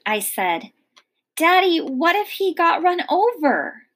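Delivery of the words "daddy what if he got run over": high, worried pleading question; anxious child. A narrator's reading. Girl to father about her lost dog, worried and high. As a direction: ask Daddy, fearing the worst.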